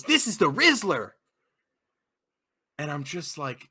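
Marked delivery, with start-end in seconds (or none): 0.0-1.1 higher, louder imitated teen proclamation; mock proud announcement. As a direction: announce it grandly like a goofy kid. Kid announces baby in stroller.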